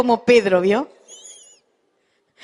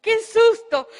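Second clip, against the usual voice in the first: susto revivido; 7 semitones higher, slower than usual. Retold or imitated.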